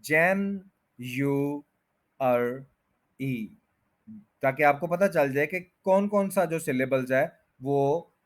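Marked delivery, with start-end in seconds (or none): 0.0-3.6 जैन, यू, अर, ई one by one, stepping down in pitch; separate syllables. Shown as a demonstration. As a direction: isolate each part: जैन, यू, अर, ई. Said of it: "four syllables को अलग-अलग बोल सकते हैं". (जैन, यू, अर, ई अलग-अलग बोलें)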